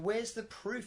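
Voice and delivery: high-pitched mock challenge; acted challenge. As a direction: demand it in a play-acted argument. Acts out countries arguing back.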